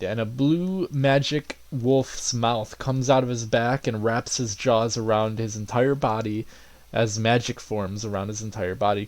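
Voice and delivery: ordinary delivery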